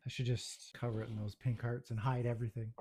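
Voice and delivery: ordinary talk, nothing marked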